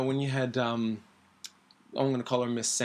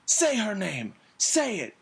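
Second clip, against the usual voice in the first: taunting demand; 8 semitones higher than usual. Acted. Villain taunts, acted quote.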